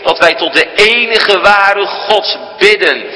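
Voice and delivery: high, ringing pulpit voice, slowed on the opening; sermon delivery at full force; full-force preaching. Acted. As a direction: proclaim it from the pulpit.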